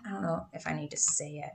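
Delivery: ordinary talk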